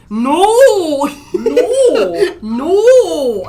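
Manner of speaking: very high, very loud shrieked no with laughter; crazy shrieking no. Retold or imitated. Retelling his girlfriend's reply to a question, laughing shriek. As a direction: shriek it through laughing like she did. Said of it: "It sounded crazy".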